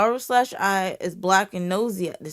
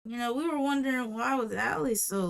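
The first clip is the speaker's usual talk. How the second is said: ordinary delivery, nothing marked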